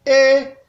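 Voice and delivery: Et held level, a step below the high one; mid tone. Shown as a demonstration. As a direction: say Et evenly, just under the top. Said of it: "Ça donne ceci". (« Et » au ton moyen)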